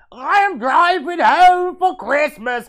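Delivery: put-on thick Cockney accent, high and slow. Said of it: "thick Cockney accent".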